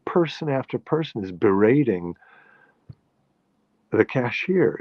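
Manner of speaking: dismayed at the world; recalling a thought of indignant disbelief; incredulous thought. Retold or imitated. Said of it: "I thought".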